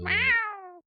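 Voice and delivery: high, falling meow; cat meow. Pretend. Friend meowing into the mic, high cat meow. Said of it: "stop fucking meowing into the".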